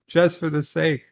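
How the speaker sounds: ordinary talk, nothing marked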